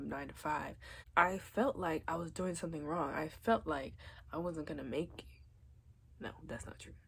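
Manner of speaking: normal talking voice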